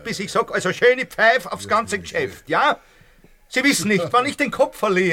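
high, loud threat of losing his head; rising fury as he warns her off; threatening outburst. Acted. Landlord warning the tenant before he loses his temper, high and loud.

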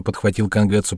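ordinary delivery, nothing marked

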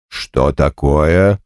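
low, slow surprised question. Acted. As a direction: ask it in surprise. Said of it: "удивлённо вскинув брови".

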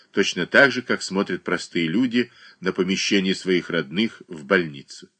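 ordinary delivery, nothing marked

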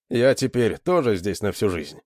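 usual speaking voice

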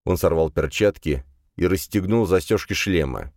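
plain reading voice

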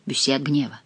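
usual speaking voice

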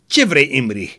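ordinary delivery, nothing marked

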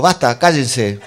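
loud mock yell from the back; retold shout. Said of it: "otro tipo desde atrás gritó". (grito imitado pidiendo silencio)

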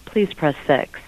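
ordinary talk, nothing marked

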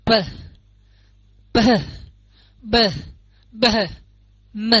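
p p b b m with lips pressed and released; labial drill. Shown as a demonstration. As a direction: pop each lip sound. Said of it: "hai môi mình ngậm lại và mình bật ra". (đọc mẫu âm môi)

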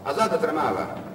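ordinary delivery

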